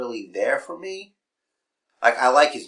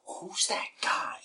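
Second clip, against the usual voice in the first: whispering; 10 semitones higher, 9 dB quieter, slower than usual. Real, not acted. Aside during the show.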